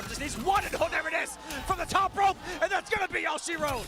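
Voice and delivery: booming, high pro-wrestling call; wrestling commentator. Real, not acted. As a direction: call the finish like a title match. Match-ending call, top rope.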